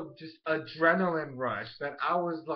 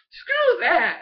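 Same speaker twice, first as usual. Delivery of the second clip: high, loud mock yell; grandma snapping back at Bolin, high and loud; defiant yell; 12 semitones higher, 9 dB louder than usual.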